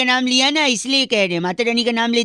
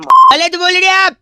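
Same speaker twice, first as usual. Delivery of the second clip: higher, louder and slow insistence; loud protest; 8 semitones higher, 11 dB louder, slower than usual. Acted. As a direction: drag it out in mock indignation. Host protests caller's objection. (ज़ोर से विरोध)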